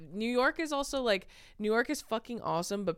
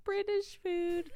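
mock British accent; 14 semitones higher, much slower than usual. Mocking British food.

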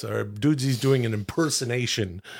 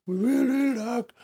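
higher, softer doting voice; gushing pet talk; 6 semitones higher, 3 dB quieter than usual. Real, not acted. Man gushes about cuddling his pet, high and soft. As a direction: dote on him with a high, soft voice.

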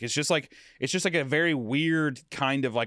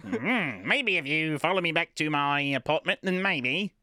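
put-on coy, swooping old New York accent; 1930s New York accent; 3 semitones higher, slower than usual. Flirt inviting someone back to the apartment, 1930s New York accent. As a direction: lilt it like a thirties New York movie. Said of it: "what I imagine a lot of New York people".

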